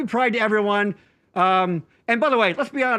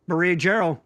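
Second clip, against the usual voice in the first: ordinary talk, nothing marked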